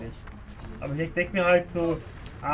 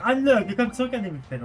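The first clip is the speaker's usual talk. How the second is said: excited outburst; 7 semitones higher, 6 dB louder than usual. Real, not acted. Excited exclamation in game.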